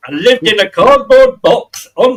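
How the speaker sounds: Monty Python Yorkshireman. Retold or imitated. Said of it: "a Monty Python moment there".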